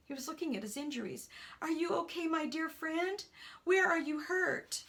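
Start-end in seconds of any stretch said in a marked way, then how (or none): 1.6-4.8 higher-pitched anxious questions; concerned friend. Acted. Friend checks fallen man for injuries, anxious and higher. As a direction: fuss over your injured friend.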